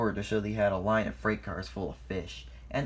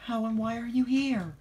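much higher, astonished question; startled; 13 semitones higher, 4 dB louder than usual. A narrator's reading. Thomas finds Lady on Sodor, high startled voice. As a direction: blurt it high in amazement.

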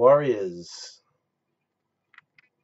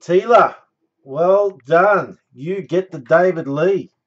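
loud, higher bingo-style calling; bingo caller; 4 semitones higher, 7 dB louder than usual. Acted. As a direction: call the winners like a bingo host. Hosts randomizer like bingo caller.

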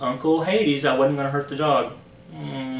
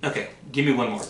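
usual speaking voice

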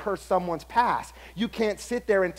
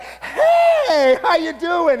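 much higher, louder fake-cheery hello; overly friendly greeting; 9 semitones higher, 10 dB louder, much slower than usual. Coworker overdoing a fake friendly greeting, much higher and louder. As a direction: oversell the hello like a two-faced coworker. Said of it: "Oh, you are overworking it".

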